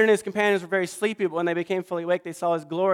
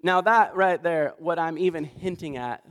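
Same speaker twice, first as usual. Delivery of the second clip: usual speaking voice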